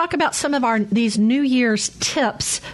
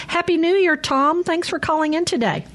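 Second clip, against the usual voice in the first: bright host greeting; 8 semitones higher than usual. Acted.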